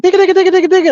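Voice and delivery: high sing-song digga chatter; sing-songy Mexican voice. Retold or imitated. Imitating the teacher's Mexican voice, sing-songy. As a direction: rattle it off high and lilting. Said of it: "he'll start like".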